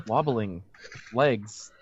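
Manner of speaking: ordinary talk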